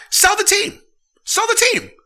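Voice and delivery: high, loud demand; angry shout. Real, not acted. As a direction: bark the order at the owners. Ranting at team owners.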